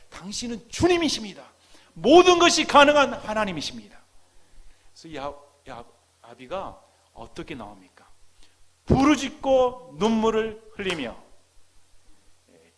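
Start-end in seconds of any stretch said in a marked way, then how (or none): 0.1-4.0 high, loud proclamation of faith; ringing, impassioned confession; fervent declaration. Acted. Father's confession of faith, acted. 8.9-11.2 slow, loud, high emphasis; preacher's slow, forceful cry; emphatic proclamation. Shown as a demonstration. Stressing how the father prays.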